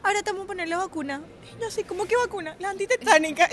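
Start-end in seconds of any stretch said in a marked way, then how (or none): 0.0-1.2 imitated doctor. Doctor announces vaccine. 1.8-3.5 mock alarm. Panicked dialogue, higher.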